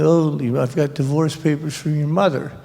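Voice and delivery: usual speaking voice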